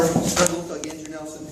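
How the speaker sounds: ordinary talk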